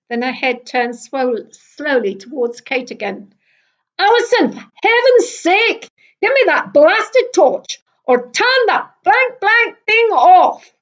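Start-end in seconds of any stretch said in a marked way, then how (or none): 4.0-10.7 building to a crescendo. A narrator's reading. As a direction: build to a furious climax, slowing the end. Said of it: "Her last words reached a crescendo".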